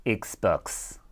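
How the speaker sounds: put-on South African accent. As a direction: drawl it out South African style. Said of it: "South African Xbox".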